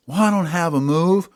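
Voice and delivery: Ollie's voice. Acted. Ollie complaining he has no move of his own. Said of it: "So Ollie says".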